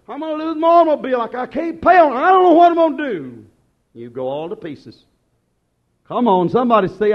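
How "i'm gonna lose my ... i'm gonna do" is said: fast, high, loud mock panic. Worried believer voiced in a loud mock panic. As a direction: imitate a frantic churchgoer. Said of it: "Somebody said".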